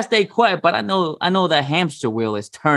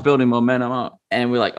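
ordinary delivery

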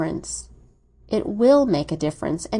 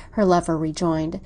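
plain reading voice

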